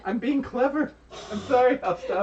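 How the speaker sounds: giggly apology. Real, not acted. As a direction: apologize while breaking into laughter. Giggly apology after teasing.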